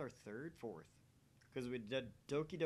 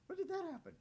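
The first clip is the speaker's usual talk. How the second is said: put-on cute girl voice; 4 dB louder, faster than usual. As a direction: chirp it like a sweet young girl. High girl voice reading Mayuri.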